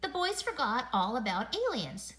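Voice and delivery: ordinary delivery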